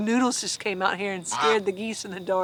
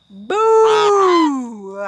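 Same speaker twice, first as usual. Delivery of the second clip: long, louder ghost boo, pitched up; ghostly howl; 5 semitones higher, 4 dB louder than usual. Acted. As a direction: howl it like a poltergeist. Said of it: "howled the poltergeist".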